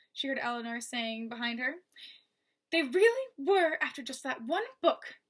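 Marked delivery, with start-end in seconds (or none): 2.7-5.2 amazed. Acted. Eleanor amazed at the gap.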